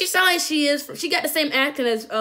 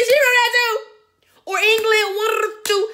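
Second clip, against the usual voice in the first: excited; 8 semitones higher, 4 dB louder, faster than usual. Real, not acted. Excited guessing about accents.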